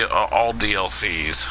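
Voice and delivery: normal talking voice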